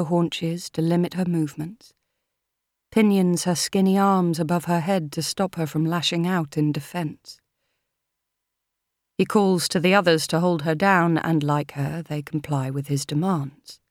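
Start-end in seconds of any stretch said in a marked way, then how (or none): none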